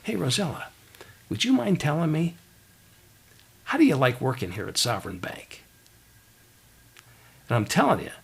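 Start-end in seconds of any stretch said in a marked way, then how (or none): 0.0-5.6 hushed, confiding question; lowered conspiratorial voice. Retold or imitated. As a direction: lean in and ask softly, as if sharing a secret. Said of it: "kind of conspiratorially".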